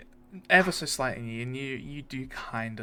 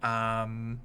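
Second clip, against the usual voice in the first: usual speaking voice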